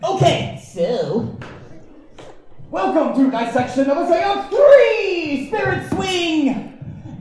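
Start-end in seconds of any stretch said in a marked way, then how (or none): 2.7-7.2 announcer. Acted. Host announcing the show title.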